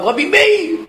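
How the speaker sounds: high startled cry of recognition; shocked cry. Acted. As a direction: gasp out the rabbi's name in shock. Family discovers the rabbi, frightened.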